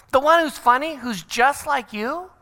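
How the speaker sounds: gushing parent. Acted. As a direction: playfully confirm exactly which kid you mean. Other parent confirming which boy.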